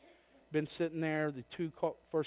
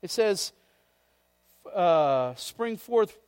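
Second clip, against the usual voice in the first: fiery preacher; full-force preaching; 3 semitones higher, 8 dB louder than usual. Acted. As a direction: preach it out to the back row.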